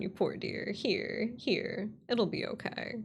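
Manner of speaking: ordinary delivery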